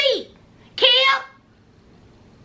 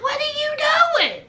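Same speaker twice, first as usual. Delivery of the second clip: high-pitched puzzled question; Kim; 8 semitones higher, faster than usual. Retold or imitated. Woman watching him pile up pillows, high incredulous question. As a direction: send the voice way up in disbelief. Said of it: "She was like".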